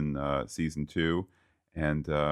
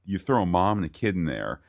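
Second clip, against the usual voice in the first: ordinary delivery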